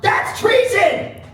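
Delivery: high, slow, loud yell; theatrical shouted accusation, stretched out. Shown as a demonstration. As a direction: yell it drawn out to rouse the crowd. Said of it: "I want you guys to yell out".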